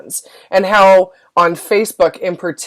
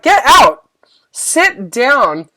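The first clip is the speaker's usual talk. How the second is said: loud, higher order to the inner voice; commanding shout; 6 semitones higher, 4 dB louder, much slower than usual. Acted. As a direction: throw the doubt out, forcefully. Orders her inner critic away.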